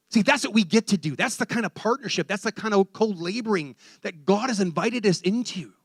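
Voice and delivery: fervent preaching. Acted. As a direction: preach it out to the congregation. Preacher builds to full force.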